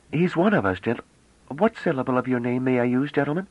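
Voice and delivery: put-on high, bright-eyed girlish voice, full of wonder; young woman Bliss, eager. A narrator's reading. Young woman marvelling at the ship, high and excited.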